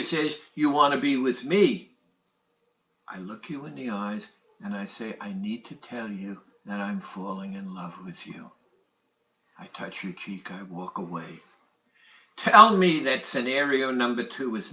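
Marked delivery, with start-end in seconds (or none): 3.1-5.2 much softer, lower, close voice; quiet and intimate. Acted. Intimate scenario two, quieter. 5.2-8.5 soft, low love confession; tender confession. Acted. 9.6-11.4 much softer, lower, close voice; quiet and intimate. Acted. Intimate scenario two, quieter.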